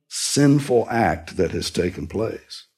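normal talking voice

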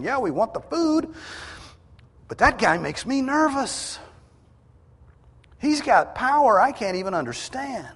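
high-pitched, agitated voicing of wary onlookers; acted uneasy crowd. Crowd wary of a powerful man, acted nervousness.

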